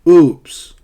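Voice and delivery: informal oops. Shown as a demonstration. Teacher showing informal talk to students, loud blurted oops. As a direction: blurt it casually. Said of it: "formal versus informal".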